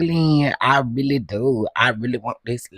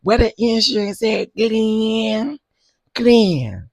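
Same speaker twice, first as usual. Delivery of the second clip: high, whiny demand at Glenn; wife's whiny insurance demand, imitated; imitating her whine; 10 semitones higher, 3 dB louder, much slower than usual. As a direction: nag him in a high whine. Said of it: "That's how she said it".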